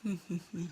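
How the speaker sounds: short mocking chuckle; dragon chuckle. Dragon laughs at the puny helmet, short smug chuckle. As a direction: chuckle after your little roar.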